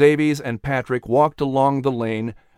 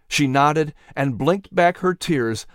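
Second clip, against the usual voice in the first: ordinary delivery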